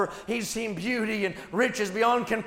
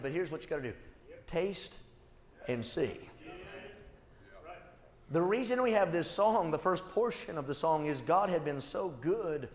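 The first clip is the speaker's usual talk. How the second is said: hushed, much lower and softer sermon voice; soft intimate preaching; 6 semitones lower, 6 dB quieter than usual. Acted. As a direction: draw the congregation close with quiet conviction. Preacher retells David, hushed.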